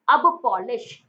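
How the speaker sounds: loud "अब पॉलिश" in two clear words; split sound-alike. Shown as a demonstration. Teacher repeating the memory trick, "अब पॉलिश" loud and split. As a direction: punch out "अब", then "पॉलिश". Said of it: "sound आता है अब पॉलिश".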